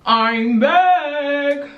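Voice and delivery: loud, high-pitched call to viewers; singsong announcement. Real, not acted. Announcing return to the vlog.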